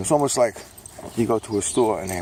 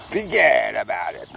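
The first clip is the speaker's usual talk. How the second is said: Brooklyn catchphrase; 5 dB louder than usual. Acted. Recurring catchphrase voice.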